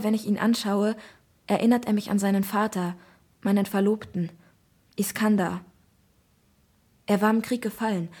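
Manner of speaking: ordinary delivery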